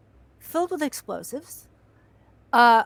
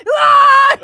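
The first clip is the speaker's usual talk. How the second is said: high loud scream; 13 semitones higher, 15 dB louder than usual. Retold or imitated. Stunt crew watching car fall, scream.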